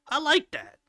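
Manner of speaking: high-pitched, delighted repeat; emphatic approval. Real, not acted. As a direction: say it with rising enthusiasm. Repeats approval with more gusto.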